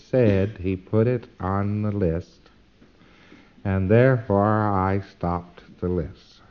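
slow, deliberate, word by word; very emphatic. Real, not acted. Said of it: "be very emphatic about".

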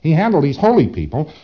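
normal talking voice

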